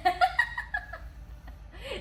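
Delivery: sudden squealing laugh; high burst of laughter. Real, not acted. Amused at Cracker Barrel joke.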